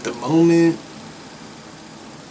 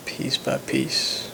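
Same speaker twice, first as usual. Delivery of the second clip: slow breathy whisper of the phrase; 6 dB quieter, much slower than usual. Shown as a demonstration. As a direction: whisper it, drawn out. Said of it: "repeats phrase whispered".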